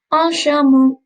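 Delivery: teacher models "Un chameau" for pupils to repeat. Shown as a demonstration. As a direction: say "Un chameau" clearly for the class to echo. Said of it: "Répétez, s'il vous plaît".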